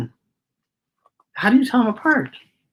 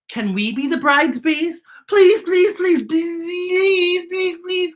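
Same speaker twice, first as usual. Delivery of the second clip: begging bees; 11 semitones higher, slower than usual. Acted. Bees pleading with the worms to be bridesmaids. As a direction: beg eagerly again and again.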